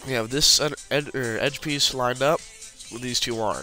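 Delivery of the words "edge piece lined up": ordinary talk, nothing marked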